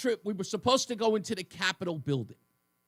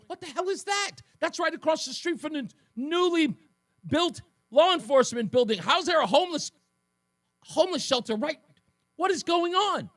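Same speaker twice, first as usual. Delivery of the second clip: higher pitched, indignant what-the-hell; outraged disbelief; 8 semitones higher than usual. Retold or imitated. Man in his forties staring at a shelter, outraged disbelief. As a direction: sputter it out, appalled at what you see.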